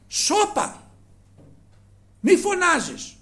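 high, sharp scolding hush; crowd hushing. Acted. The crowd hushes the blind man. (το πλήθος τον μαλώνει να σωπάσει)

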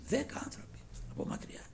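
breathy near-whisper; whispered aside. Real, not acted. Preacher whispering to the congregation, hushed. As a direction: drop to a whisper.

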